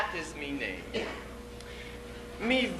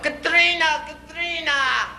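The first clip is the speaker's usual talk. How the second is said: yelling for his wife; 12 semitones higher than usual. Acted. Stuck husband calling his wife for help, high yell. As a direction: holler the name for help. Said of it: "I yelled".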